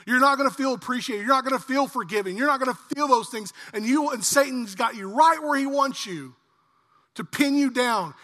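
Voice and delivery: ordinary delivery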